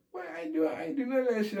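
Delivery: very high, quick retold protest; incredulous denial. Inmate reading his charges on the board, incredulous denial. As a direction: squeak out a fast, shocked denial.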